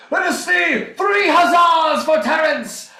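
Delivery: high, loud, drawn-out call for cheers; hearty ceremonial holler; rallying shout. Acted. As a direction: rouse the crowd, slowly hollering. Calls for cheers.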